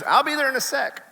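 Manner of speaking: high sing-song stalling reply; mock wife. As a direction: toss it back lightly, in a minute. Wife answering from getting ready.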